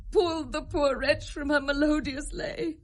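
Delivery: climbing to a weeping wail; grief-stricken telling. Acted. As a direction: voice rising high and loud into a cry. Queen tells of the drowning.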